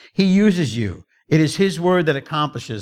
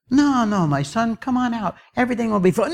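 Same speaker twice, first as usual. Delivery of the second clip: high-pitched coaxing; acted urgent reassurance; reassuring master; 9 semitones higher than usual. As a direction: soothe a frightened servant in a lifted voice. Master reassures the hiding servant.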